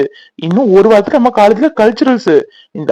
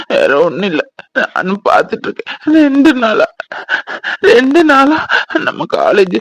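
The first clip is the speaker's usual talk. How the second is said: sobbing between phrases; crying; 2 semitones higher than usual. Acted. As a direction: confide it tearfully, gulping for breath. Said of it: "ஏன் அழுதுட்டு இருக்க?". (விம்மி விம்மி அழுதபடி)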